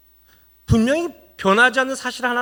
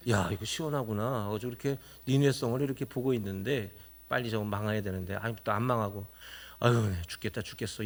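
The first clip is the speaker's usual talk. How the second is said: grumbling Jonah; 12 semitones lower, 9 dB quieter than usual. Acted. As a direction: lower and much softer muttered complaint. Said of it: "이렇게 하고 있는 거예요". (낮고 작게 투덜대는 요나)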